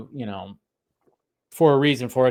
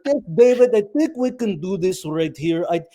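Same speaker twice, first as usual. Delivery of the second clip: put-on higher-pitched Russian villain accent; mock Russian Bond villain, talking shop; 6 semitones higher than usual. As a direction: imitate a Russian-accented city engineer. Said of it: "Sounded like a James Bond villain".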